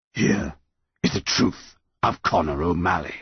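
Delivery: solemn, measured monster pronouncement; yew tree monster. A narrator's reading. Yew tree announcing the boy's truth, solemn monster voice. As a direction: intone it with weighty pauses.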